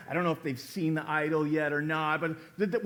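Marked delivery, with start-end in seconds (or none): none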